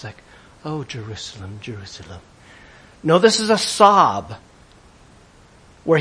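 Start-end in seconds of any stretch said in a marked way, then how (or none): 0.6-2.2 soft, low, gently murmured lament; underplay it like a tearful film hero; mock movie Jesus. Preacher mocks a film Jesus before the cross, hushed and restrained. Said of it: "a handsome man called Jesus before the cross".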